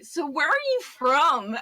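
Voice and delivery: high, loud, drawn-out mock question; mimicked nosy questioner. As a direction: imitate a stranger asking, stretched and exaggerated. Imitates Americans asking Irish travellers.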